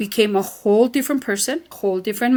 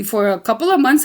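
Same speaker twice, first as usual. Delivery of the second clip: ordinary talk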